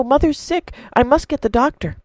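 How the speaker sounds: put-on high, hurried boy's plea; the boy telling the farmer his mother is sick, high and urgent. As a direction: blurt it out in a rush. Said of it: "cried Milo".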